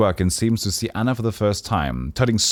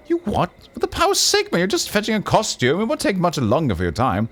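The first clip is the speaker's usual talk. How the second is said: much higher, outraged squeal of disbelief; indignant noble; 11 semitones higher than usual. Acted. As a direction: sputter at the cheek of it, pitched way up.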